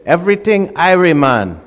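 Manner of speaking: [name] leading in Jamaican patois, sing it out; Jamaican accent. Retold or imitated. [name] teaching the visitor a Jamaican expression, in a Jamaican accent. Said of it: "I want you to repeat after me".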